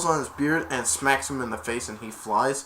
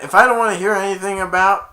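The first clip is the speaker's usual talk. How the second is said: raised, loud mock scolding; angry yelling; 6 semitones higher, 9 dB louder than usual. Dad yelling at his son about Turbo Man, exasperated. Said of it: "he was yelling about it".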